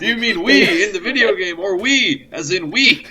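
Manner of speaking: asking through laughter; laughing. Real, not acted. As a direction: giggle while questioning. Laughing at the meta joke.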